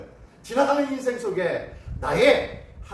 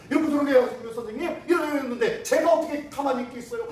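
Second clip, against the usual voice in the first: lightly acted, higher and louder self-justifying pupil; glib young offender; 7 semitones higher, 4 dB louder than usual. Offender pleading his case to the teacher, glib and worked-up. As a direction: argue your case eagerly to the teacher. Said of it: "아주 입심이 좋아가지고 아주 막 조리 있게".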